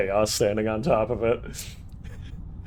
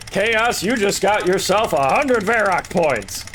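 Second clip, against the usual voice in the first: excited announcer; 10 semitones higher, 6 dB louder than usual. Acted. Voicing game announcer.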